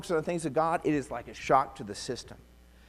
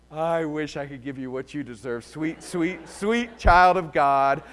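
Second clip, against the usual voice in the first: lightly sugary, slow on the last words; mock sweetness; 5 dB louder, slower than usual. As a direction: sweeten it with barely hidden anger. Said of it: "with great lilting effect".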